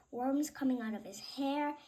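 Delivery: usual speaking voice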